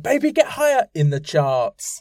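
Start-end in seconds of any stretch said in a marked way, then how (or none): 0.0-0.9 high squeaky sung line; mock singer voice. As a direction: belt it out like the pop star. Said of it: "He was like".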